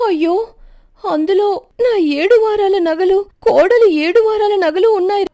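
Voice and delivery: high, loud wailing over the lost gold; wailing lament. A narrator's reading. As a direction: cry out in mock-dramatic grief. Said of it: "పెద్ద పెద్దగా ఏడవటం మొదలుపెడుతుంది".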